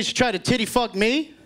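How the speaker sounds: normal talking voice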